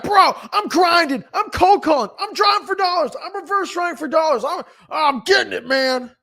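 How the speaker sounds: high, strained hype-bro voice; mock bragging hustler. Mocking phone-posting hustlers bragging.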